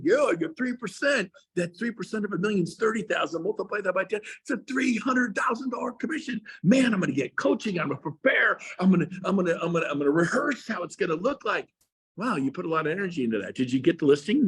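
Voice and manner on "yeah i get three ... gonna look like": pumped, high-pitched, shouting the numbers; fired up agent. Pretend. Agent dreaming of a big listing, all fired up. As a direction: get all fired up counting the commission.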